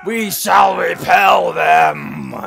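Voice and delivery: loud, slow mock-heroic shout; rally the troops, slowly; battle cry.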